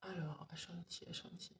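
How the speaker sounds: unvoiced whisper reading names; whispered aside. Real, not acted. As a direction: breathe the names under your breath. Reading names to herself on live. (chuchoté pour soi)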